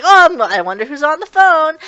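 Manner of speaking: bright and nosy, wondering who called; cheery tone. Retold or imitated. Shigure picking up the phone, cheerfully curious who it is. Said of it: "his tone and attitude completely changed".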